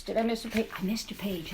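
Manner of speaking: ordinary delivery, nothing marked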